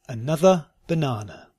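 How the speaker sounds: "Another banana" slowly, word by word. Shown as a demonstration. Teacher giving learners a sentence to try, slowly. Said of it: "first slowly and then".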